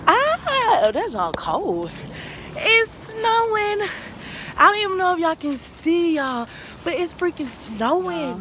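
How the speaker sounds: high, loud delighted yell; excited shout. Real, not acted. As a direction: yell into the snow, overjoyed. Shouting in the falling snow.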